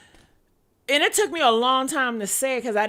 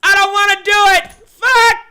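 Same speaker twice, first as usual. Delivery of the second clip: loud, high outburst of frustration; frustrated shouting; 8 semitones higher, 11 dB louder than usual. Acted. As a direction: shout it out, fed up and reluctant. Acting out frustrated reluctance.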